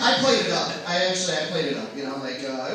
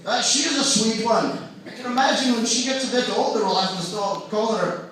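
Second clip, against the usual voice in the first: loud, higher-pitched mock Scottish accent; Sean Connery; 6 semitones higher, 7 dB louder than usual. Comic as the star ogling a toy, exaggerated Scottish accent. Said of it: "He's like".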